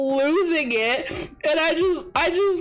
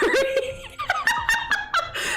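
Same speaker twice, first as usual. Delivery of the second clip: a shrill peal of laughter; high wheezing laugh. Real, not acted. Recalling the coconut hit for chat, a helpless high laugh.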